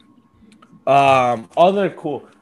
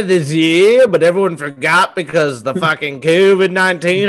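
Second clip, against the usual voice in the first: mock Australian accent; 3 semitones higher, 4 dB louder than usual. Host pitching a koala movie to Warner Brothers, loud mock Aussie. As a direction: preach it like an outraged bushman. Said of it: "That accent change".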